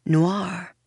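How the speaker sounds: Noir said the French way, nwar; Noir, Fransızca kara kelimesi gibi nwar diye söylenir. Shown as a demonstration.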